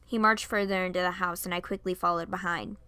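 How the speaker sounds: usual speaking voice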